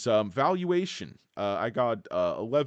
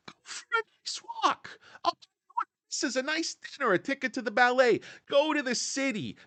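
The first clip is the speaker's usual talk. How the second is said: high, loud mocking voice of a market boss; mock trading boss; 10 semitones higher, 4 dB louder than usual. Stock vlogger mocking trading desk bosses, high sneering voice. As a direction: sneer it like a smug desk boss waving traders off. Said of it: "They just tell the trading desks".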